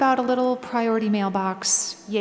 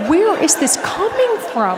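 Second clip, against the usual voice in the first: panicked; 8 semitones higher, 5 dB louder than usual. Retold or imitated.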